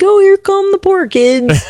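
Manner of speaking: high, slow sing-song jeer; mock taunt. Voicing team's mocking greeting to rivals.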